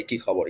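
"Ki khobor?" tossed off low and offhand in Bangla; casual Bangla greeting. Shown as a demonstration. Host showing the casual greeting between friends, offhand. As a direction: drop "Ki khobor?" casually, like to a sibling. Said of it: "that's the casual form".

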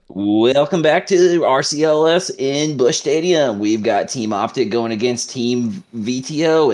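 slow, high sportscaster welcome easing down; put-on esports broadcast opener, stretched out; broadcaster voice. Shown as a demonstration. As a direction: announce the match to a stadium audience. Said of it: "That's a broadcaster voice".

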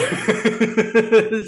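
loud high-pitched laugh; real cackle at his own punchline; high laugh burst. Real, not acted.

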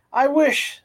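usual speaking voice

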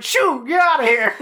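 high, mock shooing of a pest; hammed-up high-pitched shoo; imitated firemen shooing. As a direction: act out flustered firemen chasing it off. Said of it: "So they're just like".

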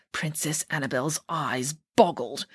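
plain reading voice

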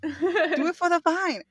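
laughing tease. Pretend. Teasing chant of vine quote.

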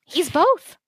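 teasing sing-song, high pitched; playful high tease. Real, not acted. Playful tease about Comet.

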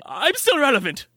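high, dramatic self-pitying chosen one; mock hero voice. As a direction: ham it up like a tragic famous wizard.

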